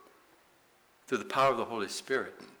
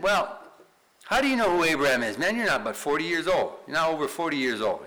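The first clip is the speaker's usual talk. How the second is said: louder, higher mock challenge; challenging Pharisees; 5 semitones higher, 6 dB louder than usual. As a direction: act out incredulous questioners taking Jesus on. Acting Pharisees challenging Jesus.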